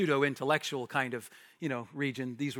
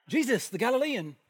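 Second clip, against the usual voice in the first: put-on high, lightly British slave girl; playful British accent; 8 semitones higher than usual. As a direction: put on a hint of British, pitched up. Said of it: "I don't know if she had a British accent".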